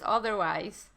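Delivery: ordinary talk, nothing marked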